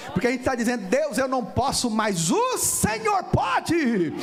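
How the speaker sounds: full-force declaration, last words held long; impassioned preaching shout. Real, not acted. Believer confessing dependence on God, impassioned shout. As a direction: build to a loud, high, stretched cry. Sermon climax, voicing a plea to God.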